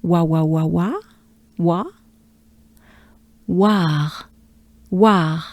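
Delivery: wa in short repeats, then War with breathed r; French wa glide. Shown as a demonstration. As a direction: drill wa before adding French r to War. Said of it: "we are looking for wa".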